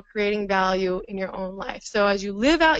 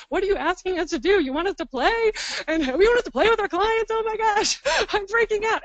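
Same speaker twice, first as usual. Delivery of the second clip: high, fast, hammed-up freaking out about play; mock panic; 9 semitones higher than usual. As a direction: act out the clients' mock alarm. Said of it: "some angst around playfulness".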